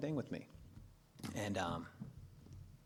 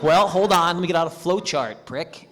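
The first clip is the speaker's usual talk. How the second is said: sarcastic retort; 6 semitones higher, 13 dB louder than usual. Retold or imitated.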